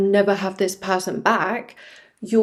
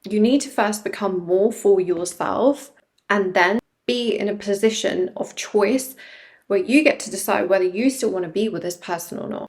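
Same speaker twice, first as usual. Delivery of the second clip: normal talking voice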